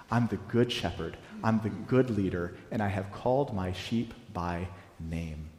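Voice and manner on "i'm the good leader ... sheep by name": usual speaking voice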